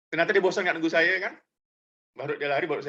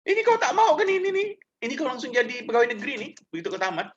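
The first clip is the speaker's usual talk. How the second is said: exasperated official; 8 semitones higher than usual. Retold or imitated. Dean pressing a picky student to accept, high exasperated voice. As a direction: push the offer, incredulous.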